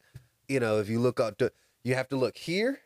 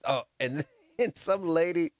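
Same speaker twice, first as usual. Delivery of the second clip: usual speaking voice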